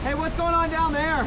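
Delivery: high-pitched call across distance. Real, not acted. Asking paddlers downriver what happened, calling out. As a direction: call down to the others.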